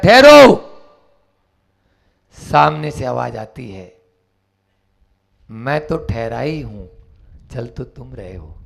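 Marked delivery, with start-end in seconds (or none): 0.0-0.7 loud harsh halt order; sudden loud shout. Acted. Bandit shouting at the monk to halt. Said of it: "एकदम से तेज आवाज में, टड़टड़ाती आवाज में". 5.5-8.6 soft, low, serene answer; calm reply. Acted. The Buddha calmly answering the shouting bandit.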